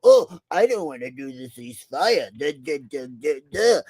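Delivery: dopey whiny mock voice trailing into duh duh; mock him as a dimwit; mocking Zelensky. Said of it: "accurate impression of Zelensky".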